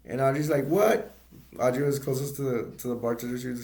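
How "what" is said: high, loud rising question; deafened "what?". Retold or imitated. Deafened Audrey answers her dad, high loud mock question. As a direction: imitate someone who can't hear.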